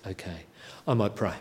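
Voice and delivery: ordinary talk, nothing marked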